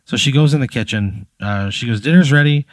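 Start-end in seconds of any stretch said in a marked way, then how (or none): 2.0-2.7 lightly raised, higher dinner call. Acted. Wife announces dinner to husband and guest, calling out. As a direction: call it out to the household.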